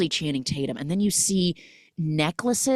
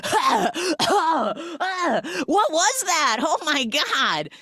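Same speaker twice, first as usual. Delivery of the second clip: panicked gasping; 12 semitones higher, 4 dB louder, slower than usual. Retold or imitated. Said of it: "immediately is like".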